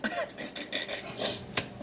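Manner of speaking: high, soft, voiceless laughter; real teary giggling fit; helpless laugh. Real, not acted. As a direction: laugh breathlessly at your own tears. Laughing at her own tears.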